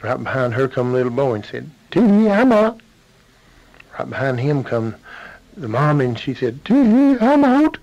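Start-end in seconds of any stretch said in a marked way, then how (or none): 1.9-2.8 high, loud giggly shout; gleeful little boy. Acted. Little boy escapes the bear's belly. 6.6-7.8 very high, loud falsetto squeal; gleeful mama. Acted. Mom escapes the bear's belly.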